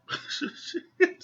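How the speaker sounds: short airy laugh, high and unvoiced; a quick breathless chuckle; breathy laugh. Real, not acted. Laughing at the reality show fight, short airy laugh. Laughing at Lindsay not caring.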